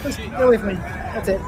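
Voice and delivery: ordinary talk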